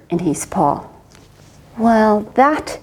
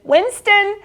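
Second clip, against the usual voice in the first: light bark; 10 semitones higher than usual. Acted. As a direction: call like an eager dog. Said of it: "barked Bert".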